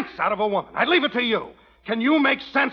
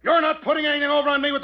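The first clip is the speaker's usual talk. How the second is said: angry shout; 6 semitones higher than usual. Acted. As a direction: shout so she hears upstairs. Yelling at the absent widow.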